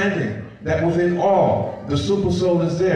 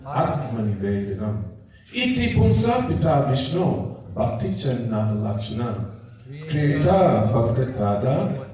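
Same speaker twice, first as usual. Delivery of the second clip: recited Sanskrit verse; 6 semitones lower than usual. Acted. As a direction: intone the verse calmly, line by line.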